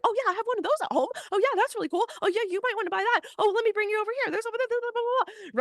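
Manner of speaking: high, fast, hovering sales clerk voice; mock a clerk hovering at your shoulder; needy store clerk. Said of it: "this graspy, desperate, needy energy".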